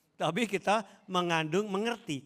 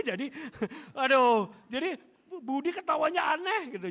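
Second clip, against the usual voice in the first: higher, laughing imitation of teasing classmates; laughing mock; 9 semitones higher, 5 dB louder than usual. Preacher mimics classmates laughing at Budi, giggly and higher. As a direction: giggle it out like kids mocking.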